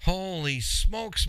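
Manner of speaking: high, slow, drawn-out holy smokes; stunned exclamation. Retold or imitated. Driver stunned by repair bill, slow amazed exclamation. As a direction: let the shock stretch it out. Reacting to fifteen thousand dollars.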